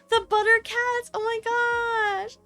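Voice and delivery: high, bubbly excited voice; cute anime streamer. Real, not acted. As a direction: gush over the raiders. Greeting raiders excitedly.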